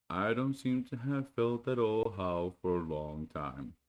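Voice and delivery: slow, gloomy donkey reply; lightly voiced glum donkey; gloomy Eeyore. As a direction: sound like nothing ever goes right.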